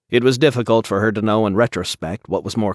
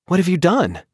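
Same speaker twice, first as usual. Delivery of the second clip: alarmed; 10 semitones higher, 3 dB louder than usual. A narrator's reading. Wife alarmed at bleeding husband.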